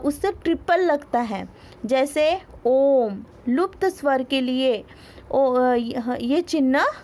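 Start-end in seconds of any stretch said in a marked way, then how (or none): none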